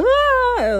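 high, desperate fake cry; mock baby wail. Shown as a demonstration. Showing how she exaggerated crying for her mom, high wail. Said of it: "Like a baby, kinda".

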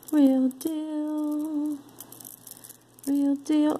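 high playful singsong "Real deal". Pretend. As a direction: chant it like a little tune. Singsong echo, much higher.

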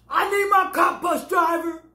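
high shouted demand; retold demand. Woman demanding her cup from the driver, mock shout. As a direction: holler for the cup.

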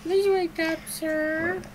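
high, polite game-character voice. Acted. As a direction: plead politely in a high pitch. Voicing game character waking someone.

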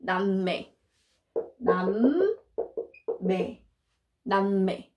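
남매 said slowly, each syllable clear; slow model repeat. Shown as a demonstration. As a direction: drill 남매 slowly for learners. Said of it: "we're 남매".

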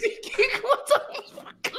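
laughing quote. Retold or imitated. Quoting him amid laughter.

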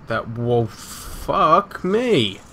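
normal talking voice

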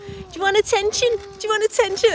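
high, loud teasing dad voice; imitating dad. Dad teasing little sister, loud high mock voice. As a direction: tease like dad did. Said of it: "he used to say it to my little sister".

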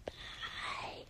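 eerie breathy whisper; creepy whisper. Pretend. Whispering goodbye to fans, eerily. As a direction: let it hang like a ghost. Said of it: "that was kinda creepy".

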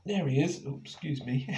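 usual speaking voice

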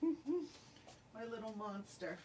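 soft, slow chuckle through it; laughing fondly. Real, not acted. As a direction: laugh lovingly at the cat. Laughs about her cat.

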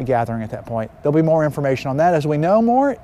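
ordinary talk, nothing marked

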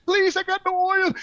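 a touch of mock pleading; pleading old lady. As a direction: imitate a little old lady begging. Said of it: "She goes".

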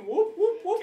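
high shouted whoops of joy; rowdy high-pitched whoop; whooping cheer. Real, not acted. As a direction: cheer it like a touchdown crowd. Celebrating a tackle in game.

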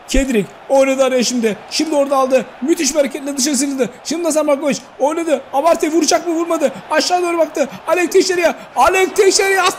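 high, rising commentary build; play-by-play. Acted. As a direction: climb toward the goal call. Live play-by-play climax.

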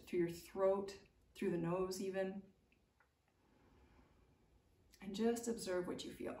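soothing teacher guiding awareness; guided meditation. Acted. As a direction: guide them gently into noticing the body. Guided pause in the body.

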